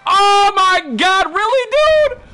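high, loud exasperated outburst; shrieking disbelief at the server; outraged yell. Real, not acted. Streamer outraged at server harvesters.

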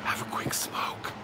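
soft unvoiced whisper. Real, not acted. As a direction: whisper it under your breath. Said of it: "Why am I whispering?".

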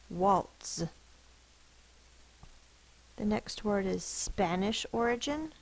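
usual speaking voice

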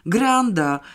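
mock shout of protest; yelling. A narrator's reading. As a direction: yell it out, not full force. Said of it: "darł się ktoś inny".

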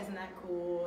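low, quiet run-through of a line; off-mic aside. Real, not acted. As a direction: say it low and softly, as a note to yourself. Muttering a missed line to herself.

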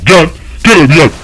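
loud, high, repeated summons; urgent calling out. Real, not acted. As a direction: shout them over in rapid bursts. Calling game enemy over.

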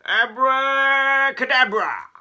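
high, loud, drawn-out spell; magician's incantation. Acted. Keith waving his wand around, grand spell-casting cry. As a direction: proclaim the spell, stretching every vowel.

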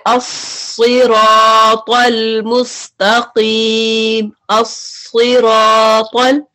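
अस्सिरातल मुस्तकीम recited very slowly with a heavy स; chant-like slow recitation, full-mouthed; recited heavy Quranic. Shown as a demonstration. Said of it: "सूरह फातिहा में पढ़ते हैं".

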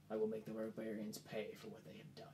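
hushed, breathy threat of revenge; low unvoiced whisper, full of menace; whispered vow. Arano whispers his vow of revenge on the barbarians, hushed.

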